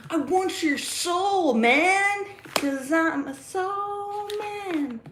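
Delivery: high, drawn-out mock plea; hammed-up wrestler impression; The Rock. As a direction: stretch it out slowly, half singing. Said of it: "He's like".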